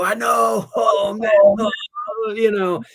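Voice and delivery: loud, high, frantic fake excuse; panicked kid. Boy to his buddy, faking a panicked spill. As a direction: play the boy covering his accident in a panic. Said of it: "I go".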